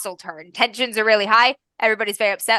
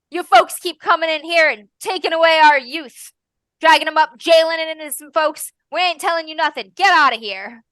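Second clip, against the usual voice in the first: raised, pitched-up accusing rant; angry townsfolk; 7 semitones higher, 4 dB louder than usual. Acted. Townsman shouts the constable out of town, angry. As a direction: drive the constable out, louder and louder.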